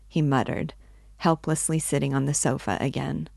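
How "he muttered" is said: usual speaking voice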